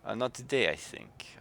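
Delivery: "not today" redone with a light French accent. Shown as a demonstration. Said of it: "I had a French accent".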